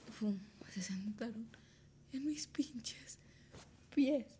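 slow, hushed whisper of the creepy moment; eerie whisper. Retold or imitated. Telling how something sat on her feet, slow eerie whisper. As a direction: whisper it like a ghost story.

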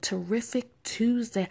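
ordinary delivery

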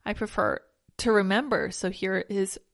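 ordinary delivery

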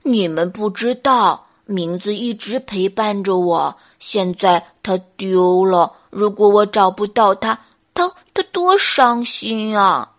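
earnest clumsy bear. Acted. Bear explains his attachment to his lost name, mournfully.

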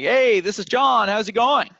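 loud, high and peppy; high-energy greeting. Retold or imitated. Upbeat prospect picking up the phone, loud and high. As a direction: greet like someone full of energy.

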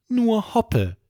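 ordinary delivery

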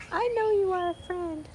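very high, tiny insect voice; little voice. A narrator's reading. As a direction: pitch it right up, small and high. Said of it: "the little voice went on".

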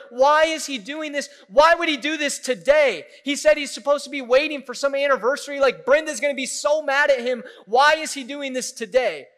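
high, loud panicked questions; freaking out. Retold or imitated. Congregation watching wine poured out, hammed-up panic. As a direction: rattle off alarmed whys, mock panic. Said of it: "And we were freaking out".

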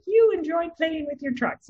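high squeaky frog puppet voice; a parent doing a high-pitched frog puppet for a child. Retold or imitated. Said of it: "listening frog would be like".

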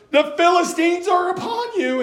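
high, loud, sing-song alarm; anxious cry. Retold or imitated. Delilah wakes Samson with a mock anxious high cry. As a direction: call out the warning in mock alarm. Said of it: "in a real anxious voice".

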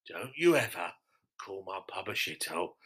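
mock-menacing quiet threat. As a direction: warn him low and soft.

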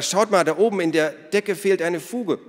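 high, loud, urgent pointing-out; calling out. A narrator's reading. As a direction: call everyone to look up, excitedly. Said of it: "rief Ada". (aufgeregt und laut gerufen)

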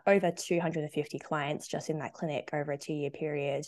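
ordinary delivery

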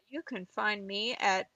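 usual speaking voice

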